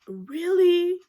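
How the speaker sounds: exasperated scolding of the groom; indignant disbelief. Retold or imitated. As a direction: demand an answer, outraged. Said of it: "I was like".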